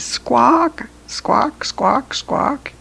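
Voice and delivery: high rising parrot squawk. Acted. Parrot answering the friend, high rising squawks. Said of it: "And the parrot says".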